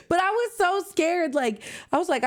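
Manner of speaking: ordinary talk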